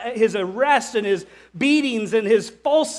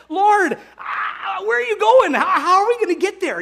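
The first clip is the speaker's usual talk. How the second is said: much higher, loud panicked questions; panicked Thomas; 10 semitones higher, 5 dB louder than usual. Retold or imitated. Thomas pressing Jesus about the way, panicked and loud. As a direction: blurt it out in a panic. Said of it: "Thomas is like".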